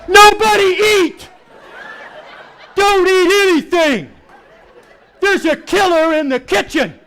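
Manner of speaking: high, frantic alarm about the kitchen; mock panic. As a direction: viewer panicking over a news tease, high and frantic. Panicked reaction to news tease.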